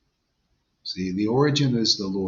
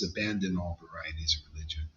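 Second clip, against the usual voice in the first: soft whispered scripture line; hushed quote; 8 semitones lower, 12 dB quieter than usual. Retold or imitated. Guru quoting Krishna to his listeners, whispered. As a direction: breathe the words out as a sacred whisper.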